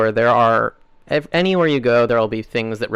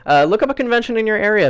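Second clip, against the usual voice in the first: higher, faster, brighter pitch; energetic restart; 10 semitones higher, 3 dB louder, faster than usual. Shown as a demonstration. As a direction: launch it again with gusto. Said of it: "I mean, I'll, I'll do it".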